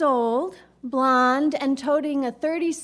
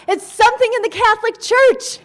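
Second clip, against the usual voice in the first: put-on higher, louder, eager kid's answer; triumphant answer; 8 semitones higher, 7 dB louder, faster than usual. Fifth grader answering the instructor, triumphantly. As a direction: blurt it out proud and sure. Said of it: "I triumphantly answered".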